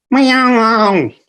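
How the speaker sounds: high drawn-out cat meow. A narrator's reading. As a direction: mew like a hungry cat. Narrator voices the cat.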